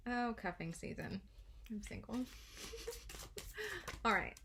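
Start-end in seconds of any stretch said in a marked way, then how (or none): 2.2-4.0 soft high laugh. Real, not acted. Laughs about being single.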